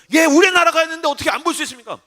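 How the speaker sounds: fast, high mock outrage; indignant fan. Young soccer fan answering his doubting pastor, mock indignation. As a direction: imitate a worked-up fan, words rushing out high. Acts fan protesting again.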